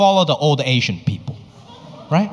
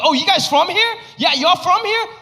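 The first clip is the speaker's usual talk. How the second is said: much higher, mock-eager greeting; mocking tourist; 12 semitones higher than usual. Comedian parodying greeting locals after a show, in a much higher mock-eager voice. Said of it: "I'm like".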